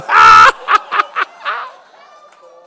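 big, high burst of laughter; loud laugh. Real, not acted. Pastor cracking up at his own joke, loud laugh.

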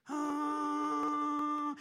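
long, high, loud blare; imitated car horn. Sister-in-law leaning on the horn, blaring honk. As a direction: hold it like a stuck horn. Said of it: "she just drains the battery".

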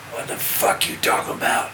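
hoarse, whispered rasp. Retold or imitated. Musician answering the interviewer's question, imitated whispery rasp. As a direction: imitate a voiceless, croaking growl. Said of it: "And I hear this voice go".

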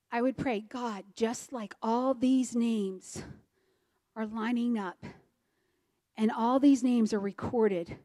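soft, measured prayer with long pauses; reverent prayer. Retold or imitated. Husband praying from Matthew's genealogy in answer, softly reverent. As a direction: pray it quietly, phrase by phrase.

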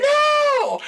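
high, drawn-out comic "no"; mock panicked cry. Storyteller reliving fleeing from a squirrel, mock panicked cry. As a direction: cry out in exaggerated alarm.

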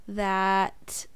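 ordinary talk

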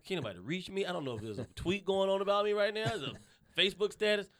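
hammed-up phone-withdrawal panic; mock panic. Acting out panic without a phone.

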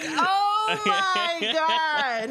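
long drawn-out exasperated groan of a line; slow, drawn-out exasperation; exasperated oh my god. Retold or imitated. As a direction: drag it out, fed up. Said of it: "And I'm like".

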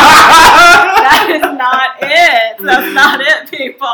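high, laughing, drawn-out scolding; laughing at a bad pronunciation; laughing exasperation. Real, not acted. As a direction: scold them while laughing hard. Laughing correction of pho.

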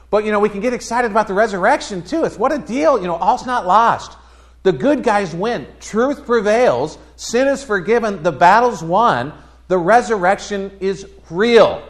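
high, loud, building proclamation; rousing preaching. Real, not acted. Pastor rallying the congregation about the resurrection, rousing preaching.

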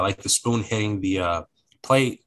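usual speaking voice

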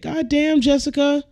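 high mock-scolding call to Jessica; high exasperated scolding. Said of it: "It's like".

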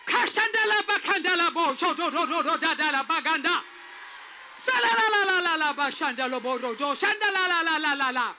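shouted rapid prayer in tongues, like going to war; loud tongues. Real, not acted. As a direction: fire it out as a war cry.

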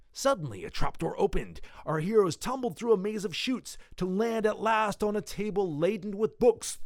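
high, building adventure-serial narration; excited narration. A narrator's reading. Narrating heroes tumbling through a trapdoor, excited.

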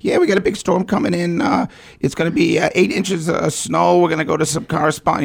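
high, eager announcer voice; kid radio announcer. Retold or imitated. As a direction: pitch it up like a kid on air. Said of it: "So I'd be like".

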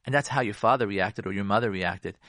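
ordinary talk, nothing marked

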